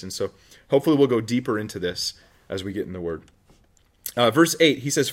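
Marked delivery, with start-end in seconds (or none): none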